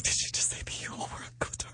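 hushed, breathy whisper of surprise; whispered disbelief. Retold or imitated. To the costar mid-scene, a stunned whisper. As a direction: whisper it like you can't believe she said that. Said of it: "And I was like".

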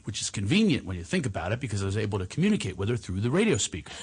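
usual speaking voice

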